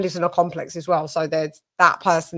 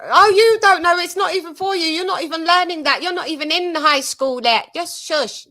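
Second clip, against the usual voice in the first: mocking big sister; 9 semitones higher than usual. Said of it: "and then I told her".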